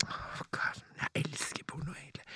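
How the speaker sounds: unvoiced confiding whisper. Real, not acted. Admitting a guilty secret to the host, whispered. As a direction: lean in and whisper the naughty confession.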